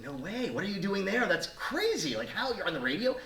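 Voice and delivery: high-pitched astonished questioning; amazed disbelief. Retold or imitated. Friend hearing of her radio job, amazed and disbelieving. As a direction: react in excited, incredulous high pitch.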